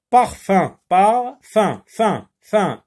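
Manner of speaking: parfum said loud twice, then fin fin alone; teacher sounding out parfum; loud model, syllable isolated. Shown as a demonstration. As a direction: hit parfum hard, then pull out fin. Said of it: "le son un s'écrit U M".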